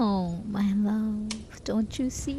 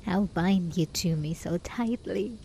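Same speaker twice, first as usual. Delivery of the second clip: normal talking voice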